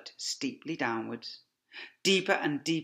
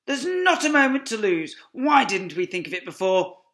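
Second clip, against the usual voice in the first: higher, louder eager fox; excited Mr Fox; 9 semitones higher, 11 dB louder than usual. Acted. Fox urging family to dig, excited. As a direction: urge them on eagerly.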